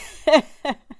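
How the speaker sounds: a quick loud laugh at a compliment; short laugh. Real, not acted. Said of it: "Thank you about my accent".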